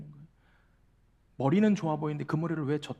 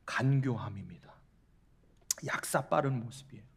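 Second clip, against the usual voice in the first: whispered; 5 semitones lower, 6 dB quieter than usual. Real, not acted. Preacher naming the sin, whispered. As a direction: let the word drop to a hush.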